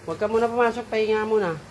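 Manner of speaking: loud, high instruction to a puppy; firm command. Real, not acted. Ordering puppy to wait and rest.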